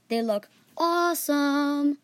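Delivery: slow, stretched awesome; enthusing. Real, not acted. Host praises the stickers, slow and drawn out. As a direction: savour the word, impressed.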